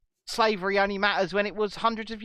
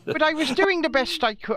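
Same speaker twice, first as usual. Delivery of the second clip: put-on Welsh accent; 9 semitones higher, 3 dB louder than usual. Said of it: "I can't do a Welsh accent".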